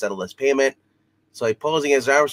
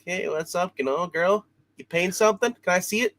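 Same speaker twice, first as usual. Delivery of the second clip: smooth flirt; 8 semitones higher than usual.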